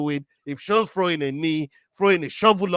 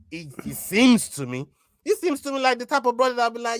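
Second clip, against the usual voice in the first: ordinary talk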